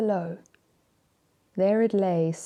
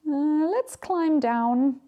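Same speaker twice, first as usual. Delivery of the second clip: slow, higher-pitched plea; pleading; 7 semitones higher, much slower than usual. Acted. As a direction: beg gently, drawn out. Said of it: "Duck pleaded after a bit".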